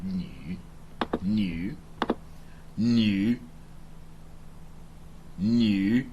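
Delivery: new with the vowel unrounded, lips spread; unrounded back vowel. Shown as a demonstration. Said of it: "It's unrounded".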